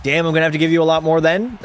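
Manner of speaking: ordinary talk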